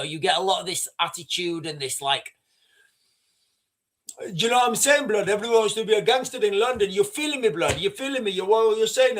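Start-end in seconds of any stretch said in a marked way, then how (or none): 4.2-9.2 put-on London street accent. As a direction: poke fun at a London roadman accent. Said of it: "they talk with this really strange accent".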